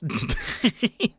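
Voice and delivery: high mocking laugh; heckler's laugh. A narrator's reading. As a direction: laugh at his own joke. Heckler laughs after joke.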